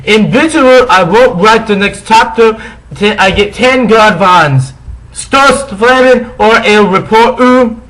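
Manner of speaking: put-on high, loud whiny girl voice; bratty teen voice. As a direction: read it like a shrill angry teen. Mock-reading bratty author's note.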